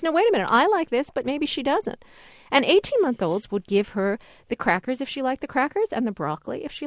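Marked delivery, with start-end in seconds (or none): 0.0-2.0 lightly voiced baby's thought, pitched high; baby realizing. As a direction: puzzle it out in a higher voice. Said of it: "does the baby realize".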